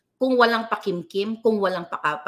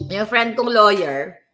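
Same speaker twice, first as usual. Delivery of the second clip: ordinary talk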